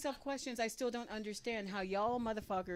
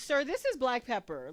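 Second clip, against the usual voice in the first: louder, incredulous; exasperated reply; 4 semitones higher, 7 dB louder than usual. Retold or imitated. Comic replying to waiter, louder and exasperated. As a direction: spell it out, fed up. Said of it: "reply to waiter".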